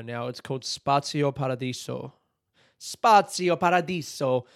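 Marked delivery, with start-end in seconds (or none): 2.8-4.5 slow, higher full Italian flourish with tapped R; Italian accent. Shown as a demonstration. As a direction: hit the Italian accent hard on Spazio Paradiso. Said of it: "Do you hit the accent?".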